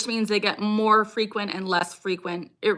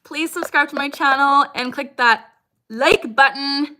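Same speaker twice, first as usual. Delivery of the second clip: excited; 6 semitones higher, 7 dB louder than usual. Real, not acted. Upbeat subscribe plea.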